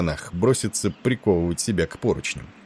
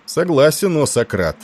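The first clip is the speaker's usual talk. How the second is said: pompous; 8 semitones higher, 6 dB louder than usual. A narrator's reading. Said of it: "важно кивнул Тиндал".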